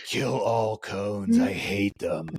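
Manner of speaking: slow, drawn-out jaded instructor; burned-out instructor. Retold or imitated. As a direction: drag it out like a Jedi turned Sith Lord. Burned-out instructor, Jedi turned Sith.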